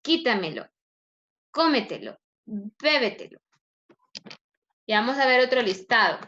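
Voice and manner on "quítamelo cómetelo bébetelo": "Quítamelo", "cómetelo", "bébetelo" separately, first syllable punched; fourth-from-last stress. Shown as a demonstration. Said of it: "tras antepenúltima sílaba".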